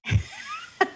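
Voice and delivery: high squealing laugh; high giggle. Real, not acted. Laughing at her own ginny joke: squealing giggle.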